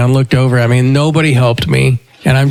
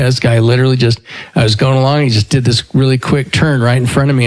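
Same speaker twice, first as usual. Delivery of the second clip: usual speaking voice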